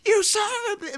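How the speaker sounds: high, loud strained impression; comic imitation of an actor losing it. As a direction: do a hammed-up meltdown yell. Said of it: "doing it in the [name] voice".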